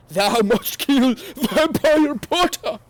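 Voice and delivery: high, loud, slow evil voice; villain yell. A narrator's reading. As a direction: boom out a dark lord's command. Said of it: "he yelled".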